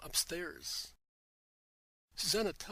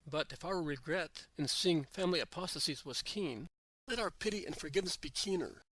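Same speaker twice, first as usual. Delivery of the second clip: usual speaking voice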